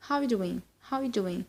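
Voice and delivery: soft, reduced words, swallowing "are"; casual fast-speech model for repeating; very fast demo. Shown as a demonstration. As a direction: run "How are you doing" together with "are" almost gone. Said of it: "to say it very fast".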